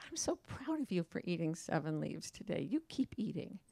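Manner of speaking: butterfly gently encouraging the caterpillar, softly; tender butterfly. Retold or imitated. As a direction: soothe the caterpillar kindly. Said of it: "the butterfly just looks at the caterpillar and goes".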